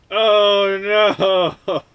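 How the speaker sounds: high, loud shriek of disgust; real disgusted cry. Real, not acted. Vlogger touching gritty dry rust, a loud disgusted shriek. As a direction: recoil from the grit with a yelp.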